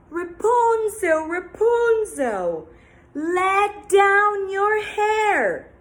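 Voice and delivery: slow loud high call; calling witch. Acted. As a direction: call up to the tower. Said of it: "every day she would say".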